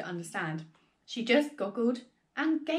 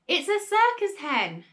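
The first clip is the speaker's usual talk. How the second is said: high, loud, drawn-out shout; excited shout; 8 semitones higher, 6 dB louder than usual. A narrator's reading. Grandma shouting down from the rooftop, high excited shout. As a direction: call it out slowly from a rooftop. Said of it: "Grandma shouted from the rooftop".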